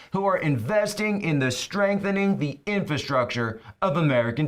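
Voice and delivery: high, booming mock announcer. Host mockingly rereads a donor story, announcer voice. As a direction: read it like a grand announcer, slowing grandly at the end. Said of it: "do this in the announcer voice".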